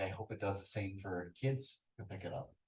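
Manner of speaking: ordinary delivery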